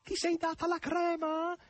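high, slow, thrilled question shouted across the air; delighted high call; excited call. A narrator's reading. Margherita calls across to Natasha.

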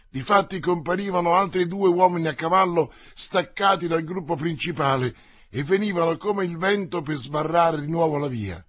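ordinary delivery, nothing marked

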